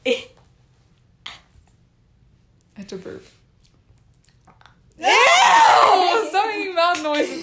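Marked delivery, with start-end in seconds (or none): 5.0-7.4 high, loud squeal of disgust; disgusted shout. Real, not acted. Telling friends to stop noises, disgusted shriek. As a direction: shriek it while laughing, drawn out.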